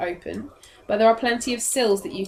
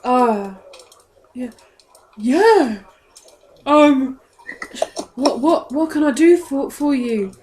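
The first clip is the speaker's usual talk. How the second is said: high, loud, stammering eagerness; tongue-tied guard keen to help; flustered guard; 4 semitones higher, 6 dB louder than usual. Acted. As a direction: stumble eagerly over your words, pitch up.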